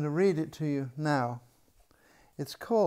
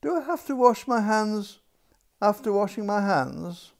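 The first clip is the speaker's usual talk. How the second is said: princess voice; 5 semitones higher, 5 dB louder than usual. Acted. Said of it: "princess asks the maid".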